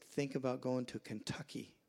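normal talking voice

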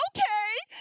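high, loud rising okay; imitating eager girls. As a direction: chirp agreement like a teen.

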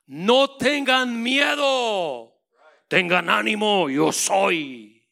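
loud, drawn-out call across the water; shouting. Retold or imitated. Christ calling to the disciples, slow loud shout. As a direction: call out to reassure, stretched slow. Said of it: "les grita".

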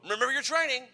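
high, loud army order; mock drill shout. Army instructors shouting at trainees in the vehicle, loud and high. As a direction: shout it past the vehicle window. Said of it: "They'd shout that out all the time".

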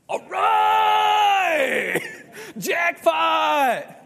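high, loud delighted yelps; giddy jackpot. Retold or imitated. Hosea overjoyed at being told to marry. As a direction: squeal like you won the lottery.

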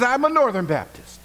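thrilled to match again; excited echo. Retold or imitated. Rescuer squealing with joy at a fellow Northern Baptist.

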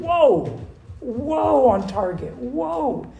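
mock protest. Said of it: "godly women who are saying".

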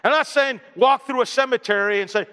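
usual speaking voice

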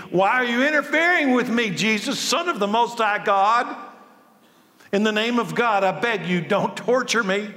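shrieking demon. Acted. Preacher voicing the possessed man pleading with Jesus, shrieking. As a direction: shriek and beg not to be tortured. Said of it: "With a shriek, he screamed".

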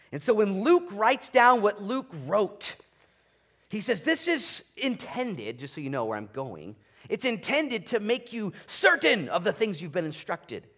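loud, high, driving sermon delivery; full-force preaching. Acted. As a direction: preach it to the back row.

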